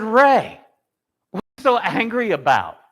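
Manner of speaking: put-on high, loud call to an upset kid. Principal calls out to an angry student, loud and high.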